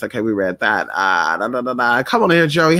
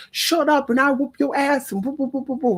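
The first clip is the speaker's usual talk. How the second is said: mock tough guy, pitched much higher; tough guy imitation; 10 semitones higher than usual. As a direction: play up his hard act as a joke. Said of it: "he try to act so hard".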